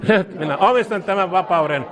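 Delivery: fervent prayer, each phrase said twice; leading a call-and-repeat prayer. Acted. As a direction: lead the congregation line by line in prayer. Leads repeat-after-me prayer.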